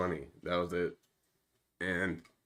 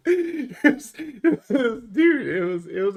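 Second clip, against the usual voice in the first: talking through laughter; laughing; 10 dB louder than usual. Real, not acted. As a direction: laugh it out, high and loud. Laughing at the embarrassing memory.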